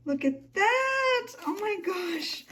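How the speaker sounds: slow, high, stretched-out coo; delighted squeal. Real, not acted. Creator gushing over a journal page, high delighted squeal. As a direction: melt over something adorable.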